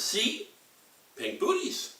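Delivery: put-on high proud little girl voice; baby girl. Baby girl showing her booties, high proud voice. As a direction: show it off like a smug toddler. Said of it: "points and says".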